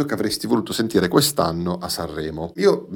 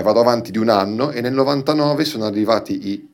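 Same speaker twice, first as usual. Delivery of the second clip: usual speaking voice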